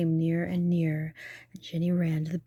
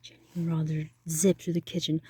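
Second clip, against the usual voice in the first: ordinary delivery, nothing marked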